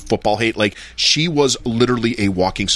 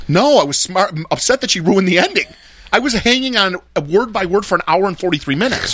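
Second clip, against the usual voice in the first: indignant protest; 7 semitones higher than usual. Real, not acted. Defending himself to friends about the spoiler, higher and indignant. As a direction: insist you were wronged, pitch up.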